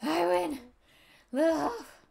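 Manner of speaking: loud, high victory shout; gleeful winner's yell; triumphant cry. Acted. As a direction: crow over winning the race. Winning the race, gloating.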